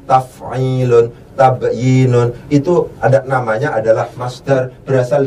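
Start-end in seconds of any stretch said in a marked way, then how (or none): 0.1-2.4 taf'ilun and tabyinun drawn out slowly; slow matched demo. Shown as a demonstration. Teacher slowly compares the two words for students. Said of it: "sama persis dengan taf'ilun".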